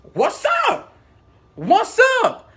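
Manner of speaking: high, loud taunting challenge; confrontational. Retold or imitated. Squaring up to coworker in retold row, confrontational.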